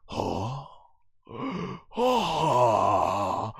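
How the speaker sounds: soft, breathy mock gasps of shock; hushed, aghast double take; imitated stunned gasps. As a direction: gasp like a cartoon hero frozen in disbelief. Imitates Vegeta's stunned reaction.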